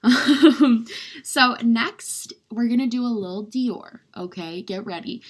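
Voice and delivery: ordinary delivery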